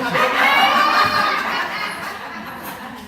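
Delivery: high, loud burst of laughter; big laugh. Real, not acted. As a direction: cackle with delight. Excited about a cute man.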